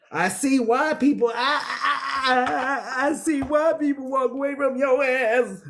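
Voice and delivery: laughing jab. Real, not acted. Reacts laughing at the person.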